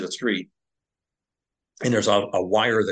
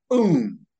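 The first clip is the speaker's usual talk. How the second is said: imitated blast; 6 semitones higher, 6 dB louder than usual. Describing the IED explosion.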